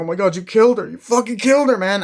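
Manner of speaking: loud, very high horrified shouting; imitating a panicked accuser; acted panic. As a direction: cry out in retold shock. Acts out shock after car accident.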